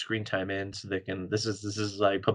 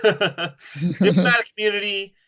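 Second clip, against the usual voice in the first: mock diplomat; 9 semitones higher, 6 dB louder, slower than usual. Hammed-up mock diplomat line.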